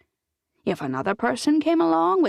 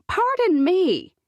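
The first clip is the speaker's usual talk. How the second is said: slow, much higher offended pardon; old woman interrupting, higher and louder; indignant interruption; 11 semitones higher, 5 dB louder, slower than usual. A narrator's reading. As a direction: cut in, mock affronted. Mandy cuts in indignantly.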